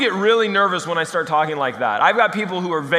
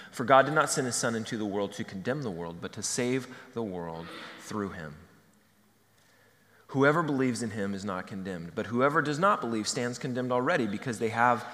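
much lower, softer reverent reading; quiet scripture reading; 8 semitones lower, 6 dB quieter than usual. Acted. Preacher reads John to the congregation, quiet and reverent. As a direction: read the verse softly, with reverence.

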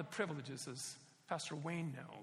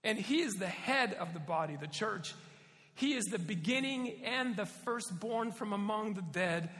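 scripture reading; 3 semitones higher, 4 dB louder than usual. Acted.

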